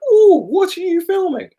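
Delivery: put-on very high, sweet old lady. Repeating the old lady's question to the vlogger, very high and sweet. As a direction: chirp it like a lovely old lady. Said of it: "She sounded just like that".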